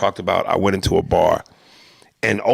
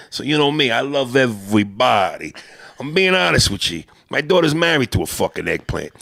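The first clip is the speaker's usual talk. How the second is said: put-on animated old-school Italian-American accent; Italian-American neighborhood tough talking to his pal; Italian-American guy; 3 semitones higher than usual. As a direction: argue like an Italian-American wiseguy. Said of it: "Italians that mean no harm".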